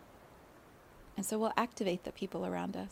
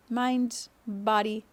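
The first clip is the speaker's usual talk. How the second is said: usual speaking voice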